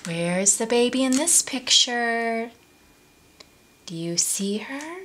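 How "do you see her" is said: soft hushed aside; whisper. Real, not acted. Asides to kids.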